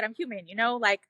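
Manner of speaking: ordinary talk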